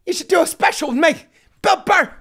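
high, loud mock Bill Burr; hammed-up Burr insisting; Bill Burr impression. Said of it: "my impression of Bill Burr".

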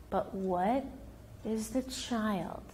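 ordinary talk

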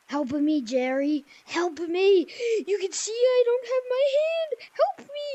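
high, loud pretend pleading yell; mock cry for help. Gamer pretends to be stuck, loud mock plea. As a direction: beg for help in hammed-up panic. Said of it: "on purpose and yell".

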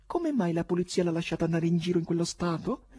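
high-pitched, scared female voice; frightened woman. A narrator's reading. Woman alarmed at the poet's state, frightened. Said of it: "L'altra femminile, spaventata".

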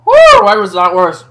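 high loud disbelief; loud exasperated. Real, not acted. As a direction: exclaim in disbelief. After the burn.

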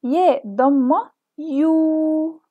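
ياء ضمة يو said very slowly, يو with rounded lips; teacher sounding out يو with damma; rounded vowel sound. Shown as a demonstration. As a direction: round your voice on يو. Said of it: "بتخلينا نضم صوتنا".

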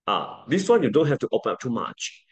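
ordinary talk

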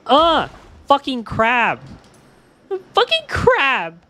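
high, loud, slow strangled gamer rage; mock-exasperated rage at a game enemy; frustrated cursing. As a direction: spit it out at the crab, fed up. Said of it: "I'm just like".

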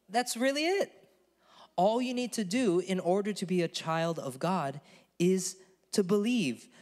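usual speaking voice

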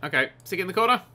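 usual speaking voice

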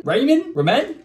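usual speaking voice

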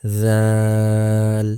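জাল as th in "this", vowel held very long; tongue tip to upper teeth, stretch জাল; tongue-tip জাল, drawn out. Shown as a demonstration. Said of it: "জিহ্বার আগা সামনের উপরের দুই দাঁতের". (জিহ্বার আগা দাঁতে লাগিয়ে জাল লম্বা টেনে)

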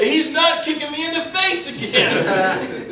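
high, loud, drawn-out protest; mock outraged refusal. Driver refusing another take, imitated outraged yelling. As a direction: yell it slowly in exaggerated indignation.

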